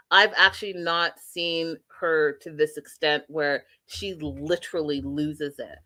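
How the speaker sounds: usual speaking voice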